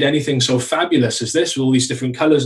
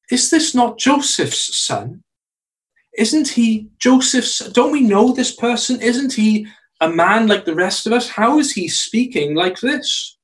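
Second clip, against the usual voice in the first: incredulous townsfolk; 6 semitones higher than usual. Acted. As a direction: sound baffled by the hometown boy. Townspeople questioning Jesus in Luke.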